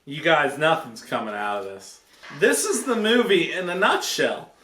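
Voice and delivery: normal talking voice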